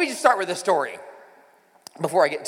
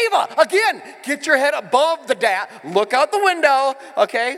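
louder half-laughing urgent call; alarmed dad; 6 semitones higher, 6 dB louder than usual. Retold or imitated. Dad shouting at daughter under the dash, louder. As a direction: tell her to sit up, louder and urgent. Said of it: "I'm like".

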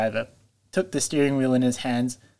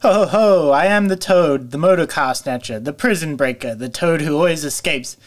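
triumphant boastful shout at full volume; reckless shout; 4 semitones higher, 7 dB louder than usual. A narrator's reading. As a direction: crow it out like a reckless braggart. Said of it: "he shouted recklessly".

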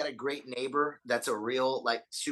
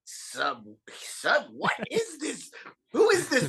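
much higher, incredulous cry; baffled outcry; 9 semitones higher, slower than usual. Retold or imitated. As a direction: squeal it out like you can't believe it. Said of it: "we were like".

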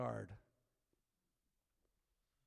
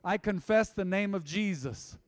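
leading prayer; 3 semitones higher, 6 dB louder than usual. Acted. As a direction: lead the room in prayer, phrase by phrase. Leads the sinner's prayer.

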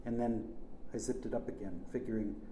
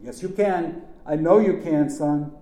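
raised, higher-pitched urging; urgent encouragement; 5 semitones higher, 11 dB louder than usual. A narrator's reading. Urging a son on, shouted encouragement. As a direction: call out loud reassurance to someone panicking.

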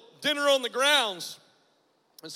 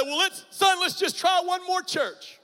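higher, slower, gently coaxing mother; pleading mother; 8 semitones higher, 5 dB louder than usual. Retold or imitated. Mother begs her son to try one more church, high coaxing plea. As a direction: coax him like a worried mother.

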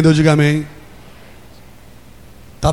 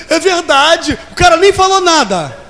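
excited outcry; 10 semitones higher, slower than usual. Real, not acted. Preacher cries out excitedly.

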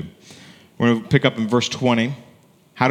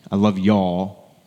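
Southern endearment; 3 semitones lower, much slower than usual. Shown as a demonstration. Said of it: "our Southern phrase".

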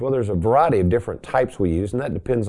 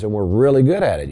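ordinary talk, nothing marked

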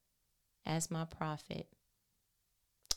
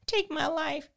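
anguished plea; 5 semitones higher, 5 dB louder than usual. Acted. Elijah's lament read with force.

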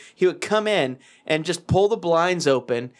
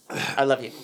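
usual speaking voice

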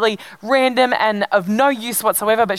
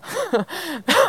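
real, soft giggle; tickled chuckle, higher than speech; amused laugh; 7 semitones higher, 4 dB quieter than usual. Real, not acted. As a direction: laugh helplessly at your own story. Tickled by her own story.

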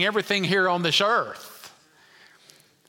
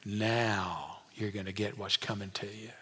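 quiet threat; 9 semitones lower, 10 dB quieter than usual. Acted. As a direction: threaten softly, pitched well down. Imagines Joseph threatening revenge on brothers.

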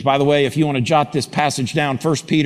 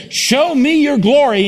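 loud plea; 12 semitones higher, 6 dB louder, slower than usual. Acted. Preached quote, loud.

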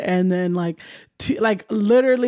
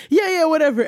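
higher-pitched, impatient mock brush-off; imitating her own distracted dismissal; dismissive brush-off; 8 semitones higher than usual. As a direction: wave him away in a retold reply. Retold brush-off while distracted.

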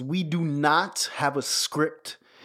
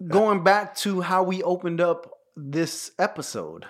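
ordinary talk

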